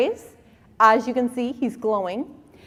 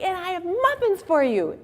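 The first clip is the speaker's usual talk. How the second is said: mock eager offer; 9 semitones higher than usual. Mom offering muffins.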